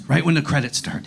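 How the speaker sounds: ordinary delivery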